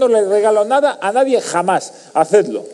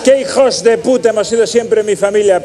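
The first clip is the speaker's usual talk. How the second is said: ordinary delivery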